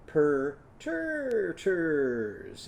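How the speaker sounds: very slow "Perterters", drawn out; slowly spelled out. Shown as a demonstration. Teacher models the new word slowly for children. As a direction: stretch each syllable of "Perterters". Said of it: "what's it going to sound like".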